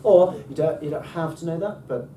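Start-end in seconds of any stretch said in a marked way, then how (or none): 0.0-0.3 OR said loud with a rise and fall; up-and-down pitch accent. Shown as a demonstration. Teacher repeats the squiggle's tune, up-and-down glide. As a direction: swoop up and down on OR. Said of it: "That means go up and down".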